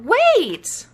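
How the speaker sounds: high, loud wait; calling out. Acted. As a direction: call after him. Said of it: "heard the dinos shout".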